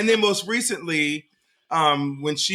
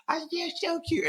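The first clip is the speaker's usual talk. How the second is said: put-on cooing baby talk; 13 semitones higher, 7 dB quieter than usual. Dad quoting baby-cooing to daughter.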